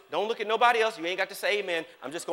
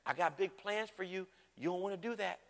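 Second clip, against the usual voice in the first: soft, much quieter, kindly caution; gentle quiet warning; 2 semitones lower, 12 dB quieter than usual. Retold or imitated. Holy Spirit gently warning the preacher, soft and kind. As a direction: warn them softly, like a patient friend. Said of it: "he's just gonna say".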